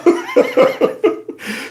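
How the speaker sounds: sudden high-pitched scoffing laugh; shrill derisive cackle; mocking high laugh. Real, not acted. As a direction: burst out laughing in disbelief. Mocking the man's claim.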